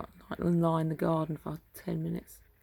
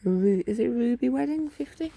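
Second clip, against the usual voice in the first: questioning; 6 semitones higher, 6 dB louder than usual. Real, not acted. Puzzling over it.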